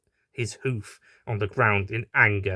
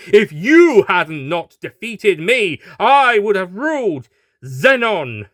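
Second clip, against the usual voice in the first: slow, loud, higher shout; shouted with conviction; 9 semitones higher, 9 dB louder, slower than usual. Acted. Horse boasting at the doctor, slow loud shout. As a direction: bellow it with conviction. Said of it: "the horse shouted with conviction".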